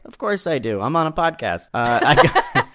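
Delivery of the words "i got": normal talking voice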